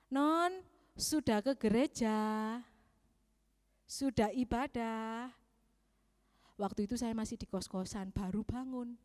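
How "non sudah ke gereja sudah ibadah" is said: lightly voiced father, slow and gentle; father on the phone. Dad on the phone asking his child about church, lightly imitated. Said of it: "bapak saya telepon".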